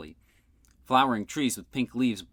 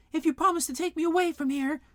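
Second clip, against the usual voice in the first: high pleading voice from inside; muffled voice; 4 dB louder than usual. A narrator's reading. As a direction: plead through the hatch. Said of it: "said a muffled voice".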